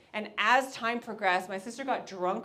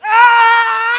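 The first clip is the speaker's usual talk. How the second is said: very loud, very high shrieking attack scream; wild battle scream; 13 semitones higher, 20 dB louder than usual. Retold or imitated. Sister attacking the guy, shrieking battle cry. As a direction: screech like a spider monkey attacking. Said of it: "like a fucking spider monkey".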